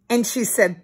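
ordinary delivery

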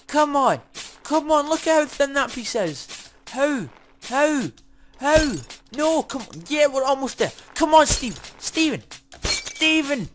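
high-pitched, insistent calling out; frantic urging. Real, not acted. Gamer urging Steven on when almost done, frantic calling. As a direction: urge him on, voice pitched right up.

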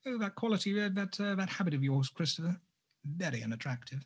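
prim little telling-off, hammed up a bit; mock mother scolding. Mother gently scolding Christopher about his habit, mock prim. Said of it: "She doesn't sound like that".

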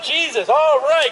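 ordinary talk, nothing marked

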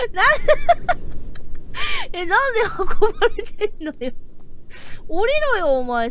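なんで運ばれてんのよ shouted through laughter; laughing shout. Real, not acted. Laughing at carried character.

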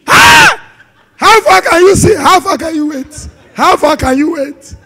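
high, very loud drawn-out shout; acted outcry. Preacher hammering a question at the congregation, acted outcry. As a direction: cry it out at full voice, stretching the words.

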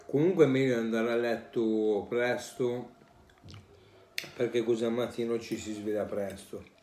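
speaking with food in mouth; words between bites; talking while chewing. Real, not acted. Seen 0: Bite at -2.0, empty fork held near mouth; still chewing while speaking.

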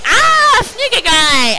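high, loud startled yelp; startled cry. Retold or imitated. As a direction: jump back in fright. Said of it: "they're like".

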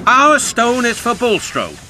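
high-voiced cross little trucks; high, fed-up pleading. A narrator's reading. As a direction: complain in a high, peeved voice. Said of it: "the trucks were crosser still".

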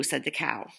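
ordinary delivery

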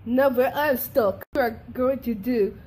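ordinary delivery

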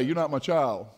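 ordinary delivery

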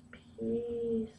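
soft, drawn-out hippie "peace". Pretend. Vlogger signing off the hippie stash, soft hippie voice. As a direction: play a mellow hippie, hushed. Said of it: "They call this the hippie stash".

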